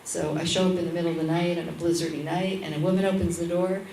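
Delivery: usual speaking voice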